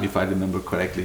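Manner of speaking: usual speaking voice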